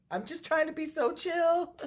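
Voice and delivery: high, sing-song mocking quote; mock forced chill. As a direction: quote it with a sneer. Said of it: "ever says".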